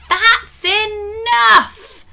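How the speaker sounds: the teacher losing patience with a shout; stern shout. Acted. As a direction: stop the whole class dead. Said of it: "shouted Miss Brewer sternly".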